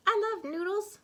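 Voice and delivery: put-on high, loud little-girl declaration; a kid gleefully announcing it; declaring. A narrator's reading. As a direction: pipe up eagerly. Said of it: "declared Jilly Boo".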